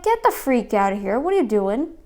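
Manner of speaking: loud, imitated kick-out yell; mock store worker. Store worker ordering the vlogger out, mock loud yell. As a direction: play a clerk throwing her out, loudly. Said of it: "I'd be like".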